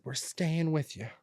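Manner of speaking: high, drawn-out imitation; mock announcement. Speaker mimics parents inviting themselves to stay, mock announcing.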